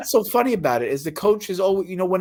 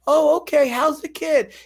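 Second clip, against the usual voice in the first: high, bright-eyed small talk; friendly cashier chat; 7 semitones higher, 3 dB louder, slower than usual. Shown as a demonstration. Cashier greets a regular customer, friendly and pitched up. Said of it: "That's how I talk to the customer".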